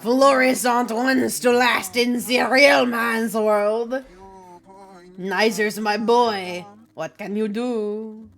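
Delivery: slow, hammed-up French accent; aggressively French. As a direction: play a scornful French bartender. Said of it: "Aggressively French".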